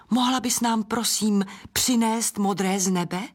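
ordinary delivery

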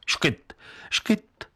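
high, breathless little hiccups; childish hiccup sounds. A narrator's reading. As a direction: squeak out two quick hiccups. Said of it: "Mohla si škytat podle libosti".

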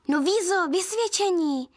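eager prompting. A narrator's reading. Girl prompting her sister about the report card, eagerly. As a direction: prompt helpfully, stretching the last word. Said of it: "napovídala jsem snaživě".

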